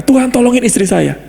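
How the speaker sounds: high, pleading cry to God; pleading prayer. Pretend. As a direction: beg like a desperate believer. Preacher mimics pleading prayer. (doa memohon dengan suara tinggi)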